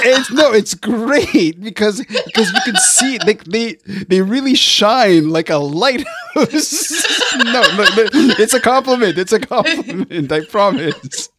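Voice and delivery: high, laughing reassurance. Real, not acted. Reassuring her the teeth remark is a compliment, laughing. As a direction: laugh while making amends.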